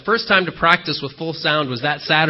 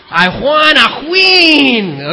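put-on slow, loud, higher Nacho Libre accent shout; 7 semitones higher, 7 dB louder, much slower than usual. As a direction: stretch out each word in a hammed-up Nacho Libre accent. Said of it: "with a Nacho Libre accent if you want".

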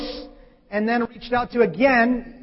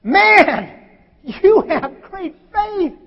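proclaiming; 9 semitones higher, 6 dB louder, much slower than usual. Acted. God praising the listener.

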